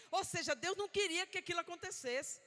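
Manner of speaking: ordinary delivery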